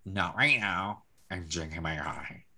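soft, low dismissal; understated, trailing-off refusal; quiet retold brush-off. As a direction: murmur it as if not to be bothered. Acted brush-off over coffee.

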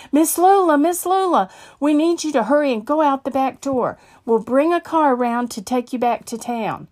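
high, loud, hurried call for Lula; panicked pastor calling out; urgent pleading. Acted. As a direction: rush her out with alarm. Pastor rushes in urging escape.

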